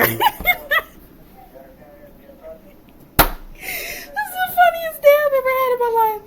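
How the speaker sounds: high, teary laugh-talk; crying with laughter over the funniest day; laughing to tears. Real, not acted. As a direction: squeeze the words out through tears of laughter. Still laughing after mocking.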